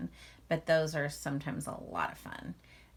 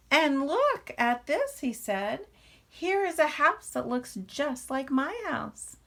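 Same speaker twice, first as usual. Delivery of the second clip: much higher and louder, wide-eyed wonder; amazed little bear; 9 semitones higher, 6 dB louder than usual. Acted. Little Bear discovering the house, higher, louder and amazed. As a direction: gasp at the house as if it's magic.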